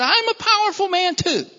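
boastful. Acted. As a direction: puff up slightly as a powerful man. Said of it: "and he's thinking".

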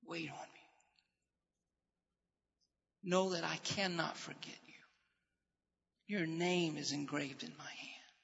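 hushed, near-whispered words of comfort; tender hushed whisper, almost breath; the Lord's gentle answer. Acted. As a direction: speak it softly as the Lord reassuring a child. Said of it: "You know what the Lord's answer is?".

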